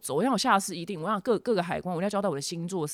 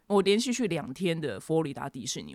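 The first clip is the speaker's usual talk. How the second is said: normal talking voice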